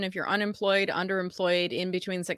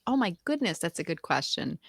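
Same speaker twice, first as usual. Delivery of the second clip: ordinary talk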